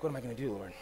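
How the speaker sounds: low, soft plea to God; upset plea. Retold or imitated. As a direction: ask God helplessly, voice dropped. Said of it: "I was really upset".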